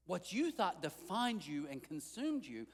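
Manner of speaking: normal talking voice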